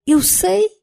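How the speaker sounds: tight, high-pitched retort; through clenched teeth. A narrator's reading. Woman replies to the man who muffled her, through clenched teeth. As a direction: answer tensely through your teeth. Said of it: "disse eu entre dentes".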